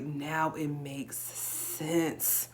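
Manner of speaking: very slow, heavily stretched words; dawning realization. Retold or imitated. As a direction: draw out each word as it dawns on you. Repeats the drawn-out realization.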